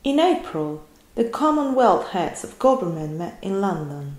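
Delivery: exaggerated British news imitation; imitating British accent. Shown as a demonstration. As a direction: mimic the Queen's clip, overdoing each sound. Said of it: "exagera cada sonido". (imitando el acento británico exagerado)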